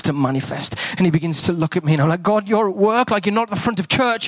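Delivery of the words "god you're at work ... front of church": excited prayer. Retold or imitated. Excited prayer to God retold.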